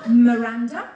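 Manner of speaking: "Miranda?" called out loudly with a sharp rise at the end; questioning call. Shown as a demonstration. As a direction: make "Miranda?" a big, loud question. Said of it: "that's the question mark".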